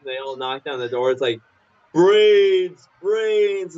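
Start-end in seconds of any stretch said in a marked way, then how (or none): 1.9-3.8 high, drawn-out zombie brains; cartoon zombie groaning; zombie moan.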